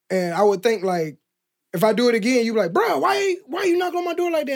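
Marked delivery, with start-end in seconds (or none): 2.7-4.5 high-pitched exasperated complaint; whiny, worked-up mock yell; mock shouting. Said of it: "you sh-, keep shouting at me".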